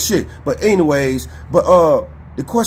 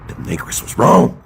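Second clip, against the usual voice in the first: mock house servant voice. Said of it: "That's how the hell you sound".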